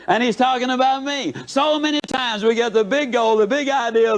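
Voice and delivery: ordinary talk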